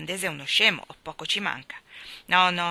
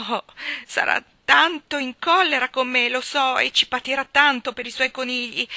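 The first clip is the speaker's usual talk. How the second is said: put-on higher and louder, desperate child; distressed plea; 7 semitones higher, 5 dB louder than usual. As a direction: beg for help, near tears. Maggie disperata per i conigli. (Maggie disperata implora Luke, voce alta e acuta)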